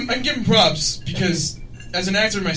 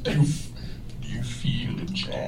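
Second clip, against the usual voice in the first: Bane voice; 8 semitones lower, 9 dB quieter than usual. Retold or imitated. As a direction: breathe it through a mask. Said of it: "do Bane voice".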